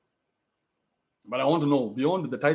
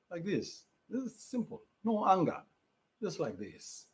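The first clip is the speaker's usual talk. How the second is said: much softer, calm and composed; calm, no anger; 11 dB quieter, slower than usual. Shown as a demonstration. Streamer showing fans how a calm man talks, soft and composed. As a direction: show how a real man stays cool. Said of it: "how a man is supposed to be".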